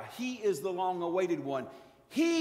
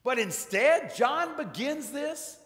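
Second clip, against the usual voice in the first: louder, higher preacher's build toward a cry; rousing preaching; 4 semitones higher, 4 dB louder than usual. Acted. Preacher pressing his flock about the first sign, rising fervor. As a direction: drive the question home to the congregation.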